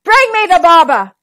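loud furious command; king snarling for the barber; snarled. Acted. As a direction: spit the order out in rage. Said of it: "Then he snarled".

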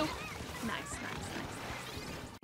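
slow unvoiced whisper of nice. Real, not acted. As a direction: breathe the words out, slowly. Quietly pleased at a kill.

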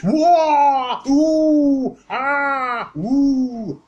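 string of high, loud martial arts yells; Bruce Lee kung fu cries. Retold or imitated. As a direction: shout out a Bruce Lee kiai series full force. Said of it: "Bit Bruce".

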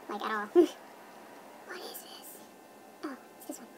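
barely voiced, whispered to oneself; whispering. Real, not acted. Vlogger checking effects alone, absent whisper. Murmuring while trying effects.

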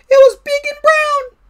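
very high, loud mouse squeal; panicked mouse cry. A narrator's reading. Mouse describing the monster to Frog, squealing. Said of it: "cried the mouse".

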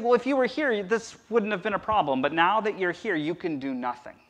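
a mild hint of grieving accusation; imitating Mary. Mary reproaching Jesus for arriving late, mildly. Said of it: "you're saying".

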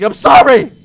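high, loud plea; wailed apology. Real, not acted. Player admits pressing wrong button, high loud apology. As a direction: cry it out defensively.

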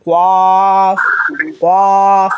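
a full heavy কফ. Shown as a demonstration. Teacher showing the heavy-voiced কফ. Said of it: "মোটা, মোটা গলায় পড়তে হবে".

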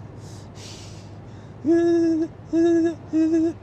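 high, drawn-out pretend whimper in bursts; mock wail. Driver playing at panic over the route, mock wailing.

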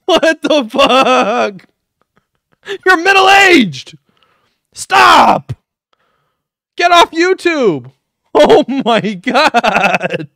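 loud, high, drawn-out outbursts; startled yelling. Real, not acted. Reactor yells back at the creepy clown voice, loud and appalled. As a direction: shout in exaggerated horror at the screen.

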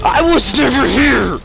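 high, loud, slow shout; shouted catchphrase. Real, not acted. Gamer yelling his catchphrase while fleeing, high loud. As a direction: belt it like a battle cry.

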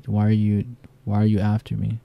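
normal talking voice

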